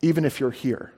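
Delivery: a hushed but unshakable retold Jesus; quiet resolve. Jesus tells the Pharisees he'll preach anyway, softly resolute. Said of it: "He's like".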